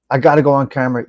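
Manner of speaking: ordinary talk, nothing marked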